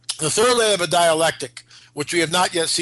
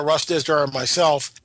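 normal talking voice

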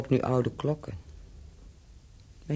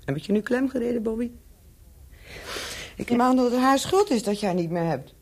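higher, louder mocking challenge; taunting jab; 11 semitones higher, 4 dB louder than usual. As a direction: needle him, pitched up and pushing. Claire corners Bobby, taunting.

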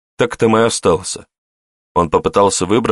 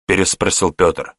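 ordinary delivery

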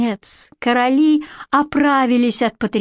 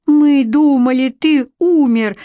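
loud, higher joyful exclaim; 5 semitones higher, 5 dB louder than usual. A narrator's reading. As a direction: cry out in joyful disbelief. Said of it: "воскликнул Чендлер".

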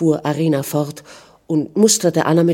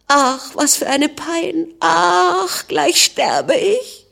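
very high, slow, drawn-out lament; anguished wail; 12 semitones higher, much slower than usual. A narrator's reading. Weeping Anna laments to her husband, wailing in anguish. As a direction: wail it in agony, stretching each sigh.

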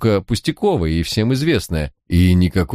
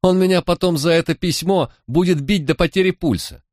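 put-on higher, indignant street-girl voice; a sulky young crook, pitched up and wronged; lightly voiced defiant girl, higher than the narrator; Manya, young thief; 12 semitones higher than usual. A narrator's reading.

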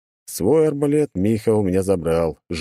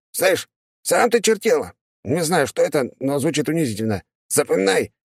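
high huffy imp; indignant demon; 9 semitones higher than usual. Acted. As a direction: bristle at the insult.

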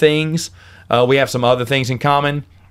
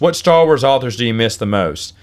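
ordinary talk, nothing marked

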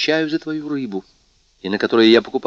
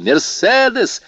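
much higher, slow happy call from beyond the door; cheerful call; 13 semitones higher, 5 dB louder, slower than usual. A narrator's reading. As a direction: call out gladly, as if from outside. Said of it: "раздался весёлый голос за дверью". (весёлый далёкий оклик за дверью)

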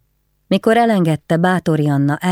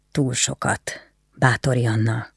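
bitter, pained; 3 semitones lower, 3 dB quieter than usual. Acted. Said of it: "inkább csak magának mondta, keserűen, fájdalommal".

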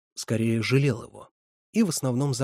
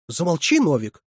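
higher and louder snapped order; sharp shout; 6 semitones higher, 7 dB louder than usual. A narrator's reading. Counselor shouts the boy down, higher and louder. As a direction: cut him off sharply. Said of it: "прикрикнула Лидия Степановна".

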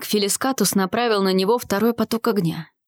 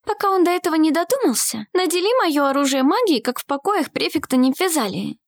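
put-on high-pitched girl's character voice; urgent young heroine; 8 semitones higher than usual. Girl instructing ally under magical shield, high urgent voice. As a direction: give the instruction pressingly, pitched up.

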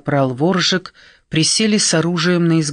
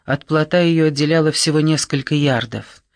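ordinary delivery, nothing marked

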